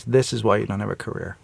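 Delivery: a hint of a stern little judge; mock stern toddler. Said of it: "And he goes".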